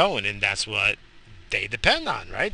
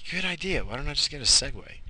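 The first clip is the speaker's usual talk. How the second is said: ordinary talk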